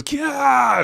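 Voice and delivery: very high, loud squeal; mock terrified "kya"; shocked shriek. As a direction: imitate a startled anime scream. Imitating her shriek of shock.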